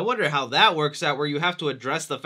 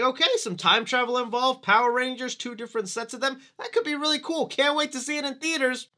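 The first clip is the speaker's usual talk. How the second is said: mock excited; 7 semitones higher than usual. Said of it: "I was like".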